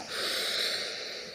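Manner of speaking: slow audible breath out; long exhale sigh. Shown as a demonstration. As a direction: let out a long, airy sigh. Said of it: "just take a big breath, big inhale and exhale".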